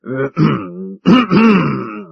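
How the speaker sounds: a loud throat-clearing cough to break the silence. A narrator's reading. Mister Audley coughs loudly to break the silence. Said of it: "He used a very loud cough".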